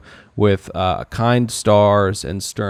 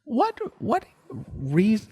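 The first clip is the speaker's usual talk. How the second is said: much higher, disbelieving; incredulous; 10 semitones higher than usual. Acted. Host mocking the idea of researching the show, incredulous. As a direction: scoff at the idea.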